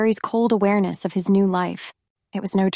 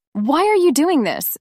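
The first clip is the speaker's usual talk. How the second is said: voice cracking; 7 semitones higher than usual. A narrator's reading. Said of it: "her voice cracking".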